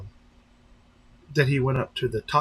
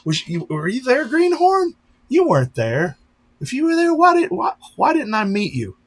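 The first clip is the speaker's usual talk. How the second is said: much higher and louder, playful accusing; mock outrage; 13 semitones higher, 8 dB louder than usual. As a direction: tease a viewer with fake indignation. Teasing a viewer who missed him.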